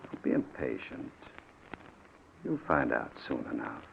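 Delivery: soft, voiceless menacing whisper; menacing hush. Acted. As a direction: keep it low and cold, barely voiced. Kidnapper calms nervous victim.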